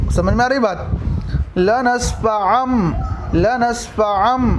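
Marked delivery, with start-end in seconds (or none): 1.6-4.5 लनस्फ़अम slowly, the final m held through the nose; slow Quranic recitation with ghunna; nasal ghunna. Shown as a demonstration. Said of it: "नाक में आवाज़ छुपनी चाहिए".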